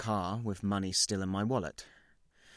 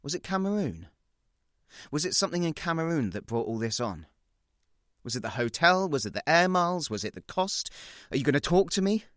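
higher, louder pleading questions; Ben pressing; 7 semitones higher, 6 dB louder, faster than usual. Retold or imitated. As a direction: push him with rising questions. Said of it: "He says".

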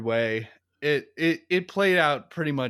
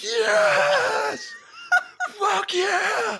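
cheering shout; much slower than usual. Retold or imitated. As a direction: cheer it like a rowdy audience. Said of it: "It's like".

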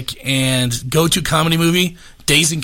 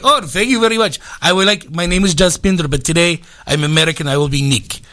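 put-on Russian-Indian accent; 3 semitones higher than usual. Indian worker accepts an American name, mashed-up Russian-Indian accent. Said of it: "it goes a little Russian, a little Indian".

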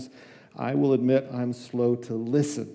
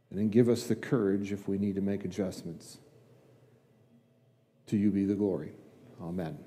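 quiet, lower-pitched heartfelt prayer; 3 semitones lower, 4 dB quieter than usual. Real, not acted. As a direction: pray softly, pausing between phrases, settling to a hushed close. Leads congregation in prayer.

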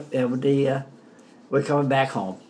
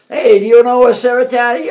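louder, higher yell across the dock; retold shout across the pier; yelled question; 10 semitones higher, 12 dB louder than usual. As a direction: call out to a stranger far off. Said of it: "yelled it".

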